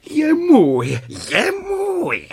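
high, gleeful victory cry; triumphant cat. Acted. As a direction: crow over the catch. Kocour chytil králíka, vítězí. (vítězný kočičí výkřik)

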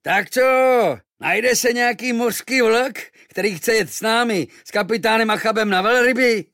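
high, loud sailor's call; loud rough seaman shouting; sailors calling out. A narrator's reading. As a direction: hail the whole harbour. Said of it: "hlasitě pokřikovali".

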